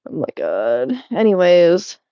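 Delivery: ordinary talk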